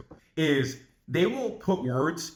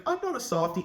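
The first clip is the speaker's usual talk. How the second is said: imitated protest; 8 semitones higher than usual. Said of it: "And she was like".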